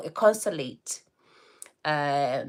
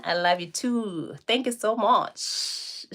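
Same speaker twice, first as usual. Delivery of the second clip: ordinary talk, nothing marked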